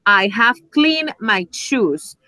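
slow, carefully spaced English example; measured teacher demonstration, word by word; slow model sentence. Shown as a demonstration. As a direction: say it slowly for language learners.

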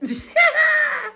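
high burst of laughter; loud laugh. Real, not acted. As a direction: cackle at your own joke. Laughing at own joke.

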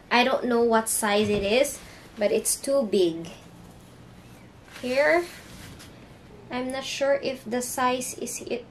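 ordinary talk, nothing marked

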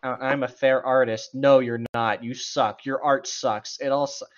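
ordinary delivery

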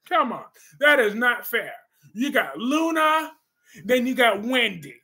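ordinary delivery

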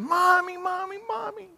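very high-pitched imitation of a scared child; mock child's scream. Hurt child running in to Mom, acted high scream. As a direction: wail for mother in a put-on little-kid squeal. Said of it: "running in to Mom screaming".

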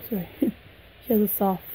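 usual speaking voice

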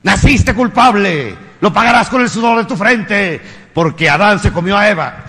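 mock priest booming guilt at a boy; stern preaching priest. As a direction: thunder it down like a priest condemning a child. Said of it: "lo llevan a misa con el padre y dicen".

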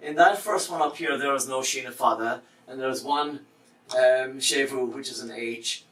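normal talking voice

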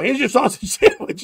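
loud, laughing hold-up line; mock robber, laughing. Robber shoving a sandwich at you, laughing. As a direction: bark it out through a laugh. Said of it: "and go".

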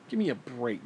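normal talking voice

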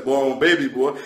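ordinary talk, nothing marked

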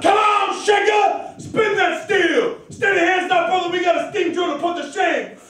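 high, full-force rallying shout; loud, high work-gang command; ringing out. Acted. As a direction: holler to the shaker over the hammering. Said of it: "it rang out".

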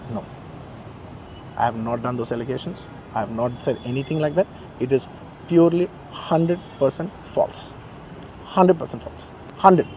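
quiet, measured denial with weighted pauses; utmost humility. Real, not acted. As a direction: state it softly and humbly, letting each phrase settle. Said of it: "say with utmost humility".

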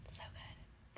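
breathy whispered aside; whispering. Real, not acted. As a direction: whisper it to yourself. Whispered reaction while tasting.

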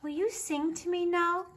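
little bear cub. A narrator's reading. Baby Bear asks his mother for a song, little cub voice.

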